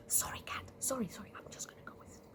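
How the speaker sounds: dropping to a hurried whisper; hushed apology. Acted. Rat to Cat in church, whispered apology. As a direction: whisper sorry as you slip out.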